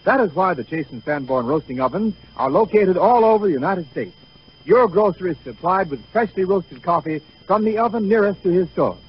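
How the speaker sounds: usual speaking voice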